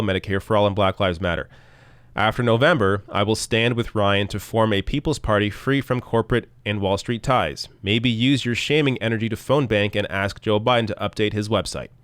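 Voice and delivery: ordinary talk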